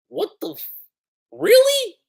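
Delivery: very high incredulous outburst; shocked disbelief. Acted. Revived character hears he is a puppet, shocked disbelief.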